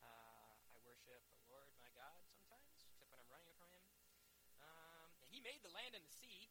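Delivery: mock sheepish answer, soft and halting; offhand Jonah. Jonah answers the panicking sailors' questions, offhand and quiet. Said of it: "He's just like".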